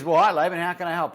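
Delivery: a hint of a warm motivational speaker picking up; imitating Les Brown. Famous speaker answers a stranger's call, lightly booming.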